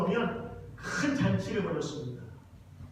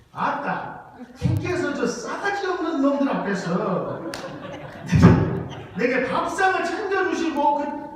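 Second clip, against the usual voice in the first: much higher, louder Jeolla dialect, laughing through it; 10 semitones higher, 9 dB louder than usual. Shown as a demonstration. Pastor reads the psalm's Jeolla dialect version to the congregation, loud and laughing. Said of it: "전라도 버전도 하나 있는데".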